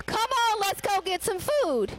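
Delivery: high, bright offer of help; inviting a hungry friend to get food; eager invitation. Pretend. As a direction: pull them along to eat. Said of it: "I would say".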